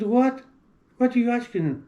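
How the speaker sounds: high, startled what?; retold astonishment. Answering a strange interview question, startled and high-pitched. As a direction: replay his own shock at the question.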